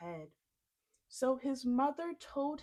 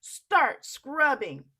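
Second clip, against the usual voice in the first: slow, loud command; ordering; 3 semitones higher, 12 dB louder, slower than usual. Acted. Giantess ordering Jack to scrub, loud and slow. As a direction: bark the order. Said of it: "she ordered".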